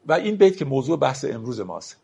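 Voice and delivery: ordinary delivery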